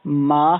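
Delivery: ਮਾਹ loud, with a complete final "h"; emphatic "maa-ha" for ਮਾਹ; fully sounded h. Shown as a demonstration. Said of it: "ਹਾ ਹਾ ਪੂਰਾ ਪੜ੍ਹੋ".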